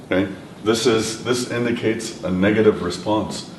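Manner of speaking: ordinary talk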